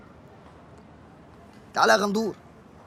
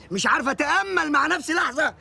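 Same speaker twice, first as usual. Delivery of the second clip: ordinary talk, nothing marked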